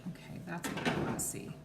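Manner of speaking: quick half-whisper aside; whispered to herself. Real, not acted. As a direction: mutter it under your breath. Finally gets the view right.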